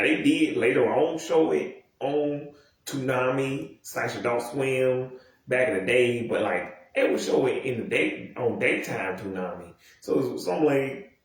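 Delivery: normal talking voice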